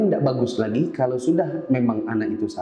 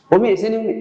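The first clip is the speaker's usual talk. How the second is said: raised voice calling the wife over; 7 semitones higher, 4 dB louder, faster than usual. Acted. Husband calling his wife to confront her, loud. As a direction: call her over sharply, then rush the accusation.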